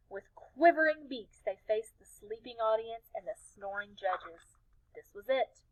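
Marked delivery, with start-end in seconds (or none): none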